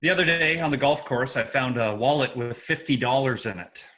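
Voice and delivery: ordinary talk, nothing marked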